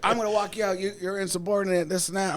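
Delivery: mock instructor scolding. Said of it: "she was like".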